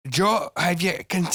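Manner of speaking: slow, high stab at Scouse; faltering mock Scouse accent; imitating Paddy the Baddy. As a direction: attempt a high Scouse impression and abandon it. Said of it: "how to do a Scouse accent".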